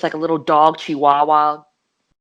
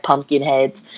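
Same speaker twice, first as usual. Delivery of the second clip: usual speaking voice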